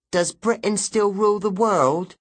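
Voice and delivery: put-on high eager child; a lightly voiced curious kid. Child asks about Britain.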